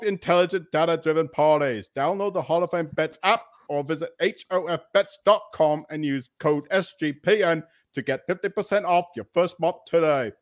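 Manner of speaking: put-on ad read starting Boston, sliding into English accent; New England-to-English accent. Podcast host reading sponsor ad in wandering Boston-to-English accent. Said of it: "like, from New England and then went to England there".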